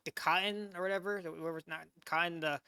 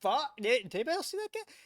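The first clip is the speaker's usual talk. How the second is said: lightly acted, higher-pitched disbelief; mock bewildered bystander, voice raised in pitch; stunned Russian soldiers; 5 semitones higher, faster than usual. Reactor voicing Russians watching the skier flee, mock disbelief. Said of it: "The Russians are probably, they're like".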